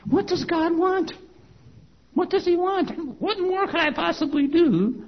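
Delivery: high, drawn-out retold plea to know; exasperated pleading. Believer asking what God wants, exasperated pleading. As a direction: stretch it out high, at a loss.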